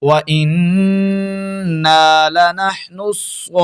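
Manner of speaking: slow, drawn-out melodic tilawat; Quran recitation. Acted. Recites the verse again. (ترتیل کے ساتھ تلاوت)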